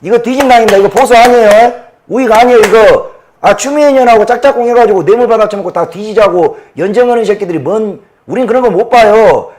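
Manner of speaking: loud, high, heated tirade; furious ranting. Real, not acted. Man ranting to viewers about his party, furious shouting. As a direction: shout your outrage at the party. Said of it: "제가 막 흥분을 해서".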